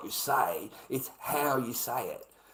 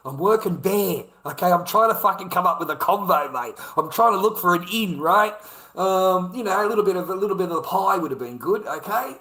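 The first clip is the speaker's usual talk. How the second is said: loud, higher-pitched exasperated rant; exasperated outburst; 7 semitones higher, 11 dB louder than usual. Real, not acted. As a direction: raise your voice in real frustration. Exasperated rant about failed small talk.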